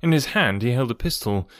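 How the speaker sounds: ordinary delivery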